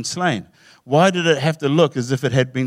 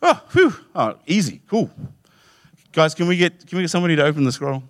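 much higher, relieved gasp then quick ask; relieved John; 6 semitones higher, faster than usual. Retold or imitated. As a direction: play John's sudden relief, rushing the question. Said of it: "I can see John going".

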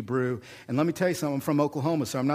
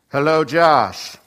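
mock booming God; 11 dB louder, slower than usual. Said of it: "Could God say".

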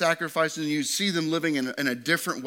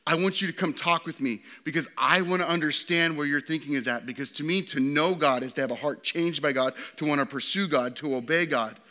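normal talking voice

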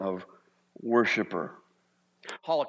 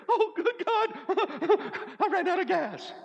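much higher, laughing hammed-up fright; mock panic. Shown as a demonstration. Pastor hamming up a scared worrier, laughing mock panic. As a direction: squeal in fake terror while laughing. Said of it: "because you're scared".

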